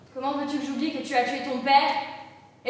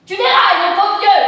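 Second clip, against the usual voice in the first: a loud, higher rebuke; loud scolding; 6 semitones higher, 13 dB louder than usual. Acted. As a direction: snap back at him loudly. Mother scolding son's crazy plan. (reproche crié)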